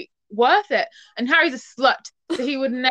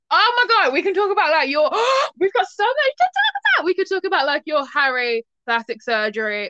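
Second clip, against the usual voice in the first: manic; 6 semitones higher than usual. Real, not acted. Excitedly lists topics to discuss.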